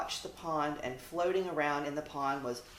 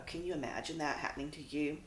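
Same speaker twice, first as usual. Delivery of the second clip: ordinary delivery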